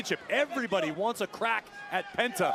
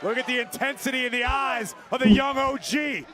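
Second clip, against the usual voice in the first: excited commentator; 5 semitones higher than usual. Real, not acted. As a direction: hype up the crowd at home. Co-commentator hyped by action.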